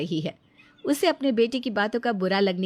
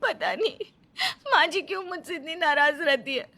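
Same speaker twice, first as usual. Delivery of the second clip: higher, crying voice with a bitter laugh; weeping wife pouring out her hurt, higher; tearful lament; 6 semitones higher, 3 dB louder than usual. Acted. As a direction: speak through tears, half laughing at it. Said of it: "बैठकर रो रही थी".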